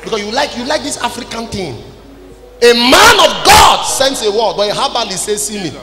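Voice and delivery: normal talking voice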